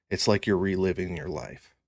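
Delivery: ordinary talk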